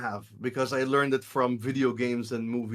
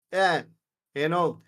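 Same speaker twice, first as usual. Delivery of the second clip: usual speaking voice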